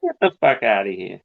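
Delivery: a hint of Boston tough guy; Boston accent. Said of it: "That's more, uh, Boston".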